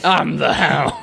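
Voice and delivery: lightly put-on tough character voice; the Hound. Shown as a demonstration. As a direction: deliver it like a cool one-liner. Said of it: "should put sunglasses on after he says".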